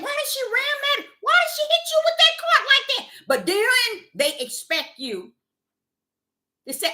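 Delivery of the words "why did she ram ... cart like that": high questioning onlooker voice; high mock onlookers. Onlookers asking why she rammed the cart, high mock voice. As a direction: ask in their high puzzled voice. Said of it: "They sit here saying".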